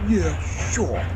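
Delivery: sceptical, grudging aside; muttering. A narrator's reading. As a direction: mutter it, not believing a word. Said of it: "muttered Patrick".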